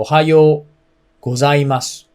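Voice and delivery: ohayo gozaimasu with the o held long; Japanese pitch accent. Shown as a demonstration. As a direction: stretch the o of ohayo, then high hayo. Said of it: "is the correct way to pronounce it".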